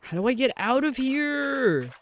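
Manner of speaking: very high, slow, drawn-out plea; exasperated wail. Real, not acted. Streamer trapped in a dark game level, high exasperated wail. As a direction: wail it high and stretch the ending. Stuck in game, pleading for exit.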